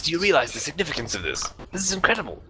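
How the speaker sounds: fast, rising excited scholar; breathless with wonder; excited professor. Acted. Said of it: "He says".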